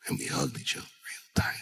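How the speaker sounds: hushed whisper to the crowd. Real, not acted. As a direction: breathe it softly, almost no voice. Tender moment, whispered.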